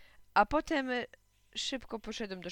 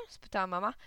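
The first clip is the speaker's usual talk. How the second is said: ordinary delivery, nothing marked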